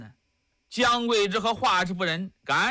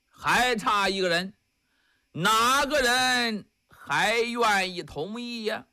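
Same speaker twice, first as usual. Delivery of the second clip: ordinary delivery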